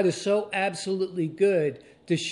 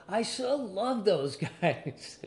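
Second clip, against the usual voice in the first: slow, chuckling everyday voice; casual fond remark; 3 semitones higher, 5 dB quieter than usual. Retold or imitated. Preacher imitating someone gushing about friends, lightly voiced. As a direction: drop into a light, affectionate aside. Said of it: "you might say".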